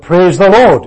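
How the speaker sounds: loud call of praise the Lord; shouted praise. Retold or imitated. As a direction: call it out in the meeting. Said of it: "he just shouted out".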